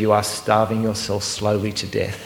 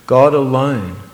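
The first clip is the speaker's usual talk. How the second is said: usual speaking voice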